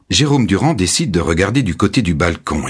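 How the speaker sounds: plain reading voice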